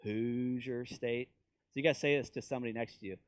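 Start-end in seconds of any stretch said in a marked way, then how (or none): 0.0-1.3 lightly imitated slow Hoosier speech; slow Indiana talk. Preacher teasing Indiana locals, slightly drawn out. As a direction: draw out "Hoosier". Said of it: "Indiana talks like that too".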